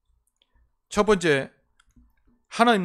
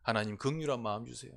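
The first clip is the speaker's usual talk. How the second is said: quiet prayer; 5 semitones lower, 7 dB quieter than usual. Shown as a demonstration. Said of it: "기도하면서요".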